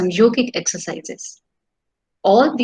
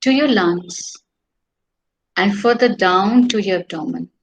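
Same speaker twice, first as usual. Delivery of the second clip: guided meditation. Acted. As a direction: lead the breathing calmly.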